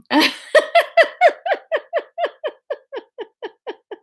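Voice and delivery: long, high wheezing laugh; helpless laughter. Real, not acted. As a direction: laugh until it trails away in squeaks. Cracks up at own accent.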